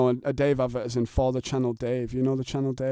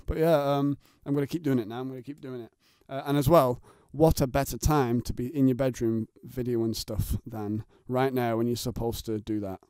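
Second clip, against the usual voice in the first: ordinary talk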